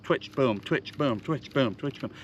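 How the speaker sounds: quick chant fading to near silence; very fast cadence. Shown as a demonstration. Showing the fast topwater walk, quick fading chant. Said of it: "Very fast".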